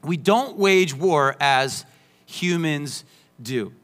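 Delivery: slow, each word weighed; slow and deliberate. Shown as a demonstration. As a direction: let it sink in slowly. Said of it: "let's pause there".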